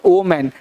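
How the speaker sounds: "वोमेन" with an o start, low and falling; singular pronunciation. Shown as a demonstration. Teacher models singular woman, "वोमेन" with an o sound. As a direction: say "वोमेन" like "o-man". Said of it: "अगर singular हो तो".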